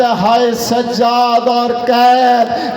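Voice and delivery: very slow, drawn-out cry of woe; preacher's acted cry of torment; anguished lament. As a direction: cry out in agony, stretching each word. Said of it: "تڑپ کے کہتا ہے".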